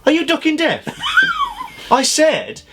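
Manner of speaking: high, laughing mock outrage; mock outraged yell. Real, not acted. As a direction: pretend to be offended, laughing.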